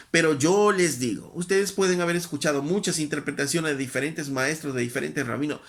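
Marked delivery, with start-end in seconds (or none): 0.1-1.3 yo hit louder with a falling tune; stress on yo. Acted. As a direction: land firmly on yo. Jesus' authoritative declaration.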